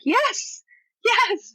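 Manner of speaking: high-pitched, emphatic cry of yes; excited agreement. Retold or imitated. Recalling a delighted reaction, excited agreement. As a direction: cheer your agreement in a high voice.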